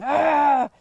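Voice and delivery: loud mock growl of frustration; imitated exasperated growl; frustrated growl. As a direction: growl out your exasperation, hammed up. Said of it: "I heard myself growling".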